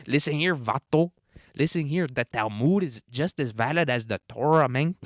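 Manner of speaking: lilting mock Mexican accent, swooping up and down; caricatured sing-song Mexican accent. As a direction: do a hammed-up Mexican accent, lifting the last word. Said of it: "Sheinbaum says".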